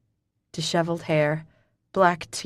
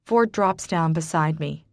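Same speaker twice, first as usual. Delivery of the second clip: ordinary delivery, nothing marked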